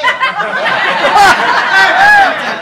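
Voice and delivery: big high burst of laughter; loud laugh. Real, not acted.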